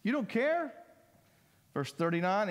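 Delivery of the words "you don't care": higher, pleading mock-child complaint; whiny kid accusation. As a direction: act out a child accusing dad, voice up high. Kids' whiny accusation voiced.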